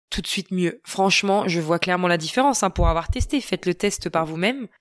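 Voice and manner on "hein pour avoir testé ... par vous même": ordinary delivery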